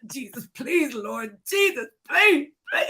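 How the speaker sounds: high-pitched retold begging; desperate pleading. Acts out begging while locked outside.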